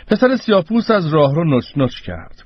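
usual speaking voice

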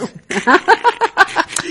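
high-pitched laughing burst; acted laugh. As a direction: laugh aloud at your own joke. Husband laughs at his own joke. (خندهٔ بلند و زیر)

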